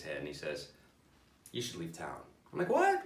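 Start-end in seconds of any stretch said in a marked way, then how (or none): 1.5-2.2 deadpan therapist. Acted. Therapist's grave advice, retold. 2.7-3.0 high-pitched disbelieving yelp; incredulous. Acted. Patient to therapist after bad advice, incredulous.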